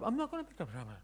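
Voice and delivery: mocking silly voice. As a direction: parrot the parent back to make him look stupid. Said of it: "back in a silly voice".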